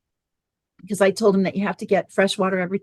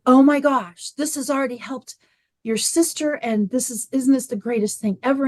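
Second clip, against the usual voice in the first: pitched up, gushing over the new water machine; gushing excitement; 5 semitones higher than usual. Retold or imitated. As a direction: sell it to your son with bubbly enthusiasm. Mother gushing to son about machine.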